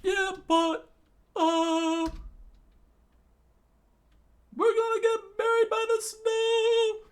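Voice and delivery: very high, loud falsetto; squeaky cartoon cry. Kid voicing a panicked character in a squeaky falsetto.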